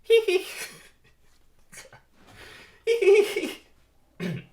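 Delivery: very high, loud mock giggle; squeaky falsetto tittering; lady bees' giggle. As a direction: giggle coyly like flattered admirers. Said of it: "two lady bees giggle at being talked about".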